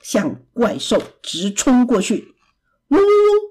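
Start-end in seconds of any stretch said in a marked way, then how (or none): 2.9-3.4 high-pitched rapid bee buzzing; attacking bee buzz. A narrator's reading. Bee charging at the monster, high buzzing. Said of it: "蜜蜂一鼓作气".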